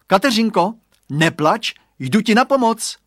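high, loud heroic call; acted shout. A narrator's reading. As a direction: shout that help is on the way. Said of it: "vykřikl".

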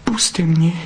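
high, pleading little vixen; frightened plea. A narrator's reading. Vixen begs the fox to release her, frightened. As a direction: beg him to let go, scared.

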